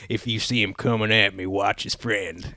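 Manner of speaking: quiet warning in an imitated Old West accent; Wild Bill Hickok. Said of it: "Wild Bill from, and he's like".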